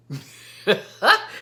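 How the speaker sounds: short, loud burst of laughter; one sudden high laugh. Real, not acted. Laughs at own remark.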